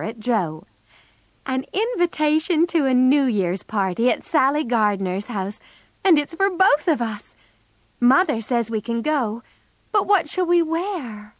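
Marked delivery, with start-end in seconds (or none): 1.5-11.3 put-on higher-pitched, eager girl's excitement; excited. A narrator's reading. Meg tells her sister of a New Year's party, excited. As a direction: share thrilling news, pitch lifted. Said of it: "said Meg excitedly".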